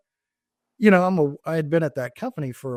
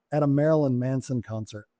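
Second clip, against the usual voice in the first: normal talking voice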